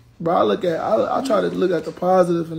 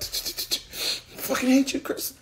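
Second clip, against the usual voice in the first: slow breathless mock of a seething hater; mock hater face; 6 semitones higher, 8 dB quieter, much slower than usual. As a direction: act out the hater's silent rage, slowly. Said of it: "Y'all face probably be like".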